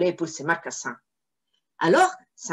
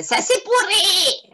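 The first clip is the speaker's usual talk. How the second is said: much higher, slow whiny devil; second devil; 13 semitones higher, 4 dB louder, slower than usual. Acted. Second devil complains to the saint, high and slow. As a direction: whine it like a disgusted imp. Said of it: "répondit la deuxième voix".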